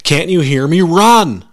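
loud, high urgent yell; forceful shout. A narrator's reading. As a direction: shout it at him again, forcefully. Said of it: "he repeated forcefully".

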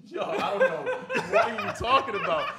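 high, giggling playing dumb; laughing denial. Kid denying to dad while laughing. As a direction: deny it while cracking up. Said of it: "I'm like".

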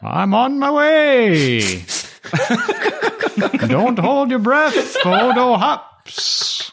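high, slow, far-off shopkeeper call; merchant voice. Acted. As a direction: drag it out like a cheerful merchant.

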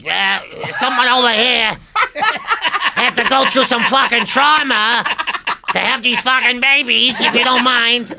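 hammed-up Boston accent, high, loud and slow. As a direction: put on a brash mock Boston accent. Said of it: "I was doing her accent".

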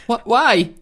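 hammed-up bewilderment; baffled local. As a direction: question it in mock alarm.

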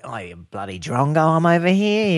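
hammed-up broad Australian, climbing high; Australian woman assistant. Phone scolds the owner who left it, mock Australian woman. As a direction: grumble as a comic Australian woman. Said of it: "mine's got the Australian women's, uh, voice".

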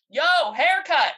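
slow drawn-out mock call; imitating Tamura. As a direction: drawl it like a bro calling out. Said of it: "off screen".

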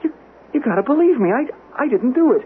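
high, loud frantic plea; desperate pleading. Acted. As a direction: beg her to believe you. Desperate son begs mother.

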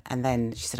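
normal talking voice

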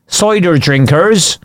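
slow, lightly burred West Country; West Country accent. Retold or imitated. Listing English accents, a slow mild West Country voice. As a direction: draw it out like a cider drinker.

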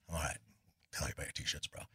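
low, very soft aside; reluctant humouring. Retold or imitated. Bandmate humours friend about his T-shirts, reluctant and quiet.

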